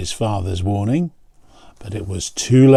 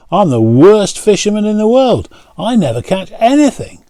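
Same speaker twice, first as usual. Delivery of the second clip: high, loud self-deprecating protest; excited protest; 9 semitones higher, 5 dB louder than usual. A narrator's reading. Charlie warns John he's the worst fisherman, high and loud. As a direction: insist he's hopeless, pitched up.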